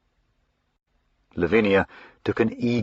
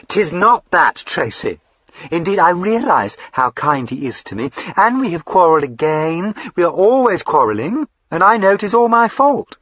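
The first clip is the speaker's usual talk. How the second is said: higher, excitable fine lady; eager young lady Lavinia; 11 semitones higher, 7 dB louder than usual. Acted. Lavinia gushing to her brother, high and eager. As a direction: gush up high like a spoilt sister.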